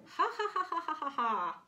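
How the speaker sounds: high, loud acted "ha-ha-ha", falling away; hammed-up laugh at a silly name; mocking crowd laughter. A narrator's reading. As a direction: reel off a big staccato cartoon laugh. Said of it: "laughed all the other animals".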